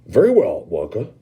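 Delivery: a rich man taking charge; self-important Mr Salt. Acted. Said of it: "he said importantly".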